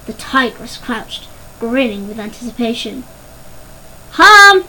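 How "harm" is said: high, loud shout of a name; loud call. A narrator's reading. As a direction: call out sharply for a missing sister. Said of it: "cried Melody once more".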